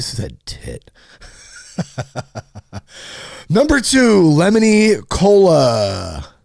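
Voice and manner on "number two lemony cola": high, loud, slow lemony cola; announcer voice. Pretend. Reading drink list, hammed-up announcer. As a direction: draw it out like a game show host.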